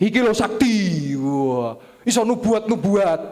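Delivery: high, loud, slow gushing awe; hammed-up admiration of a pastor; mock awe. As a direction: gush high like a dazzled follower. Voicing awed churchgoers.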